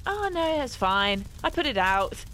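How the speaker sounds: breezy, high "oh no" then offhand; casual calm. Retold or imitated. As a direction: shrug it off like nothing happened. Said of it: "he went".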